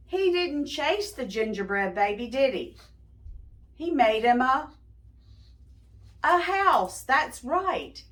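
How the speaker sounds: put-on bright questions to the young listeners; asking the listening kids to guess, bright; talking to children. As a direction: coax the kids to guess along.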